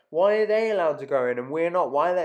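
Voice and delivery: loud, high-pitched indignant complaint; mock shouting. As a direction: imitate an outraged customer protesting at the door. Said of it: "starts shouting at the woman at the door".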